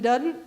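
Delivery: "doesn't" said as "dudn't"; Southern slurred form. Shown as a demonstration. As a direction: swap the z in "doesn't" for a d. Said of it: "I was saying things like".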